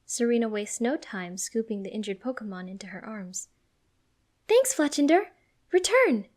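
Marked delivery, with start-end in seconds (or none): 4.5-6.3 high, loud confident command; yelling. A narrator's reading. Serena recalling her Pokemon, yelling. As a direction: yell it like a trainer's order. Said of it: "Serena yells".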